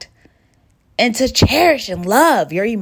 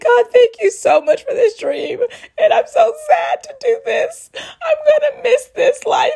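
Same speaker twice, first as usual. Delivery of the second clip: much higher, crying through thankful words; tearful prayer. Retold or imitated. Thanking God alone at home, crying and laughing. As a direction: pray while sobbing, half laughing. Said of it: "while I was crying".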